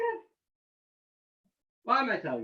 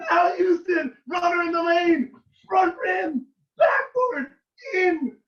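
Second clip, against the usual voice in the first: high, loud, near-tearful shouting; reliving the shot; 10 semitones higher, 5 dB louder than usual. Real, not acted. To the congregation, screaming joy reliving the winning shot. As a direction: relive the winning basket at full pitch. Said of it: "I am so excited about it".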